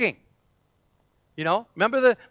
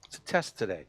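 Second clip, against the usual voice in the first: hushed voice of God; 6 semitones lower, 8 dB quieter than usual. Pretend. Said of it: "and say".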